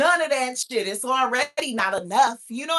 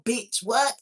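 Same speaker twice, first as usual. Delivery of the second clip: usual speaking voice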